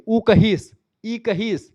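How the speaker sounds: Awadhi speech. Shown as a demonstration. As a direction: voice an Awadhi speaker saying ऊ कहीस. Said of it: "उसने कहा को क्या बोलेंगे".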